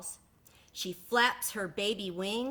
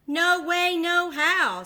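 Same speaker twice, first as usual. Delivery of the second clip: high, loud flat refusal; a little duckling refusing to jump; stubborn duckling; 10 semitones higher, 11 dB louder, slower than usual. Acted. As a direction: dig in your heels, squeaky and loud.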